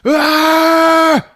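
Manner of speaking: high, loud battle scream; attack yell. Retold or imitated. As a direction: scream as you lunge. David attacks Ralph.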